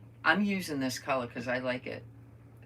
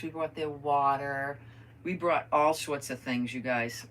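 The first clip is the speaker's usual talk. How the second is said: usual speaking voice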